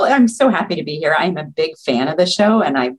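usual speaking voice

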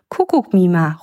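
usual speaking voice

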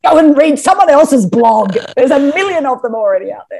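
hammed-up fed-up dismissal; exasperated rant. Acted rant at blog readers.

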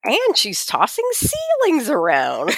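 high, slow, put-on voice; high, slow mock outrage. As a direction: lift it high and slow, teasing. Mocking the villain.

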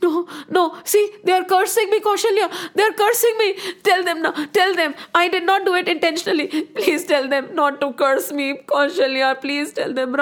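frantic high-pitched pleading, easing at the end; shouting like madman. Acted. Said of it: "Raja Dashrath was shouting like a madman".

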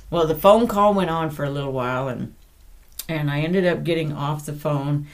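ordinary talk

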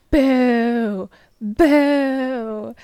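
high, loud booing; old lady yelling. Retold or imitated. Retelling the old lady booing from the crowd, loud and high. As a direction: boo loudly like a heckling crone.

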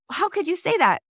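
put-on mother shocked at her kids' rude words; mock shocked mom. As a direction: gasp it out like a scandalized parent.

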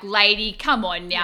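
loud, fed-up plea to the examiner; exasperated yell. Retold or imitated. Retelling the driving test, yelling at the examiner. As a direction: shout it like you've had enough.

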